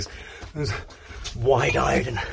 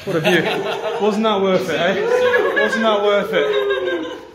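laughing; 8 semitones higher, slower than usual. Real, not acted. Reaching top, laughing.

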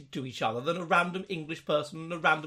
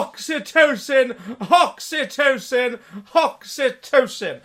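laughing exclamation; 4 semitones higher, 6 dB louder, slower than usual. Acted. Ghost voice shouts joke word.

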